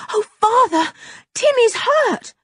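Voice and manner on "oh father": high, loud, pleading urgency; girl begging her cross father to look; urgent girl. Acted. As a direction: blurt it out in a rush of worry. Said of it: "said George".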